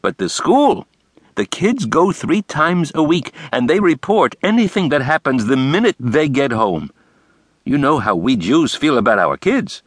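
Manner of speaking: Brooks's voice. A narrator's reading. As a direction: lay it out with flair. Brooks explaining congregation.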